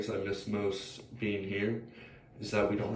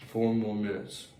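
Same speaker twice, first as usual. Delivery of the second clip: ordinary talk, nothing marked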